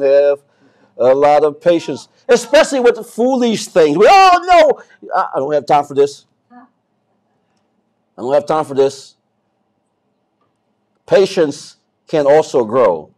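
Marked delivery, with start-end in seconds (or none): 4.1-5.1 high, drawn-out protest; acted exasperated objection, pitched up; impatient refusal. Preacher mimicking Christians lacking patience, high impatient protest. 5.1-9.1 impatient dismissal. Acted. Acting out impatient people.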